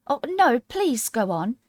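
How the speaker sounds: high, pleading and humble. Acted. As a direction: beg humbly not to upset him. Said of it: "Alice said very humbly".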